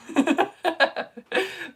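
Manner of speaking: high giggle; laugh. Real, not acted. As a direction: giggle at your own remark. Laughing at her own remark.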